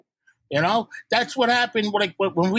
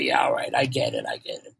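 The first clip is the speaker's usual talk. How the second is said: much lower mock voice, grudgingly backing down; grudging giving in. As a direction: play the calmed-down guy, dropping way low as he concedes. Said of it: "He's like".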